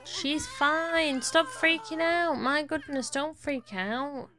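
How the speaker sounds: high-pitched, exasperated telling-off; exasperated scolding. Real, not acted. Player telling panicking game characters to calm down, exasperated.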